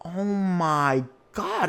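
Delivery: a slow, gasping oh my god while watching; drawn-out oh my god. Retold or imitated. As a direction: let it stretch out in shock. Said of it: "And I'm like".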